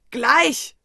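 loud, high, irritated shout. Acted. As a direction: snap back a single word loudly. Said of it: "schrie ich".

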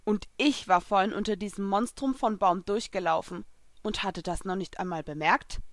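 plain reading voice

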